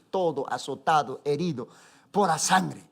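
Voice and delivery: ordinary delivery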